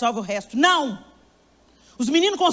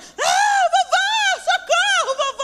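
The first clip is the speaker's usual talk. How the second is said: put-on much higher, screaming little girl; child crying for help; 5 dB louder, much slower than usual. Granddaughter hearing grandfather, screaming for rescue. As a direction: shriek for grandpa like a desperate toddler. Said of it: "aí ela fez mais".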